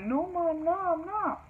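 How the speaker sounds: high, whiny mock-tearful plea; imitated crying. Teen denying it to her mom, imitated tearful whine. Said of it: "So now I'm crying".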